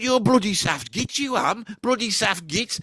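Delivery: lightly voiced Brummie telling him off; Brummy insult. Brummy Dave having a go at his creator, mock-angry Brummie accent. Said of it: "Brummy Dave goes for me. He starts having a go".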